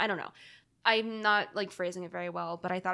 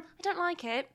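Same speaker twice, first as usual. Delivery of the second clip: British quote; 9 semitones higher than usual. Retold or imitated. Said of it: "I've always said".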